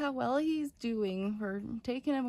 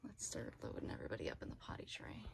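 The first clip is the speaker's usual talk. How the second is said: whispering; 4 semitones lower, 9 dB quieter, much faster than usual. Real, not acted. Whispering near sleeping puppies.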